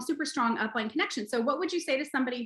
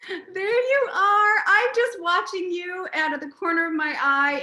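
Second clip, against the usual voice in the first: ordinary delivery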